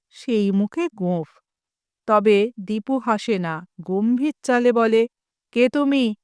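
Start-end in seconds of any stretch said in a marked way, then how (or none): none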